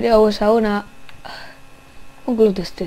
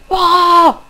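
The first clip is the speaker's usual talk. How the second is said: loud, higher shout; startled yell; 8 semitones higher, 9 dB louder than usual. Real, not acted. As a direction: jump at the surprise. Startled by a motorbike. (grito de susto)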